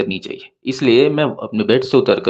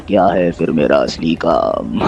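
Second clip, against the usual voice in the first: low, slow theatrical question; teasingly ominous, pitched well down; mock-mysterious; 9 semitones lower, slower than usual. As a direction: draw it out like a dark secret. Teases his real job, then laughs.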